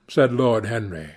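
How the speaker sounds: ordinary delivery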